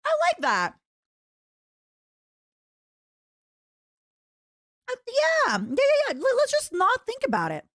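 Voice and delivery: mock yourself going along nervously in a tiny high voice; squeaky nervous agreement. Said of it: "I was like".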